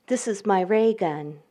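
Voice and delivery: usual speaking voice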